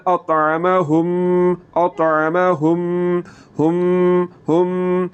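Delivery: very slow اطعمہم, the mim hummed through the nose; ghunna nasal hold. Shown as a demonstration. Tajweed teacher demonstrates ghunna on ہم, slow nasal hum. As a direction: stretch ہم into a long nasal hum. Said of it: "آواز کو ناک میں لے جا کر تھوڑا سا لمبا کرنا ہے".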